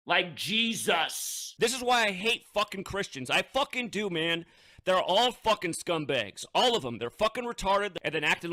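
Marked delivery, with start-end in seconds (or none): none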